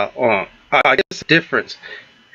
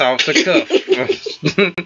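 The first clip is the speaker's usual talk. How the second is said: laughing echo; much slower than usual. Real, not acted.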